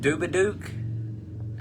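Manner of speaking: "Dubaduke" stretched out slowly, puzzled; fumbled pronunciation. Real, not acted. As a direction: slowly sound out "Dubaduke" as a guess. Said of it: "how the fuck do you say that?".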